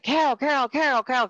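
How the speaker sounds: high, urgent calls of her name; urgent calling. Retold or imitated. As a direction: call her back to waking, pitched high.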